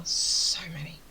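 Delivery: breathy whisper with a long "So"; hushed, stretched "So many"; whispered emphasis. Real, not acted. Whispered emphasis, then repeated voiced.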